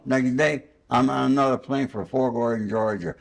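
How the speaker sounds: ordinary talk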